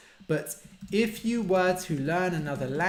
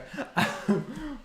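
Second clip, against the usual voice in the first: high laugh; 10 semitones higher than usual. Real, not acted. Laughs at failed owl hoot.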